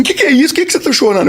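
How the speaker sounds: imitating a crying man, pitched high; high mock-tearful question. Shopkeeper asks the singer why he is crying, tearful. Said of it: "o cara começou a chorar".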